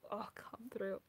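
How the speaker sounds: soft, awed whisper; hushed thrilled aside. Real, not acted. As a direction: breathe it in delight.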